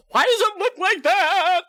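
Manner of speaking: high, loud disgusted yell; horrified yelling question; disgusted shout. Retold or imitated. Disgusted outburst at picture.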